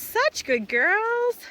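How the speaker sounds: high, very slow cooing; cooing praise. Real, not acted. Farmer cooing to her cows as they come, slow high coo. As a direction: stretch out the praise like to pets.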